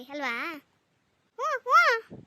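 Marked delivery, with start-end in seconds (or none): 1.3-2.2 high, loud whiny hum through the nose. Acted. Sulking daughter whines back at her mother, high whiny hum.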